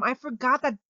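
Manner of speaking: ordinary delivery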